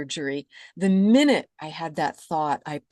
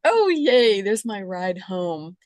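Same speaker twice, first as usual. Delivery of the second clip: delighted cheer; 3 semitones higher, 6 dB louder, slower than usual. Retold or imitated. Exhausted soul spotting the escalator, cheering with relief. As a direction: greet the escalator like a rescue. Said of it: "I'm like".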